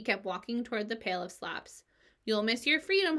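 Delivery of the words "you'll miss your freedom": higher honking goose voice. A narrator's reading. Goose honks at Wilbur walking away. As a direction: honk it after him like a goose. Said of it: "honked the goose".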